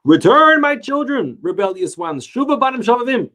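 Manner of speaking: high, loud calling out from on high; booming divine call, pitched high; heavenly proclamation. Retold or imitated. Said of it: "And it proclaimed".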